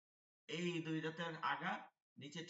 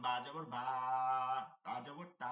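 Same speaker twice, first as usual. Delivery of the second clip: final বা and তা held very long; long stretched vowel; 2 semitones lower, 6 dB louder than usual. Shown as a demonstration. Teacher showing alif's pull, stretched vowels. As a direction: draw out the a in বা, তা. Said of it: "টান পড়তে হয়".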